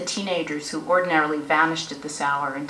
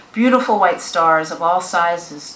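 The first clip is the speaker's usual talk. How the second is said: plain reading voice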